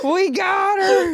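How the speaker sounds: high, drawn-out hammed-up crowing; slow, high mock victory cry; mock gloating voice. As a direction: imitate a triumphant internet accuser. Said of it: "somebody literally is gonna pull this clip up".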